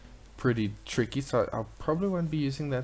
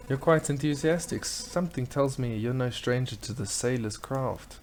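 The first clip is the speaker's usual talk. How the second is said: usual speaking voice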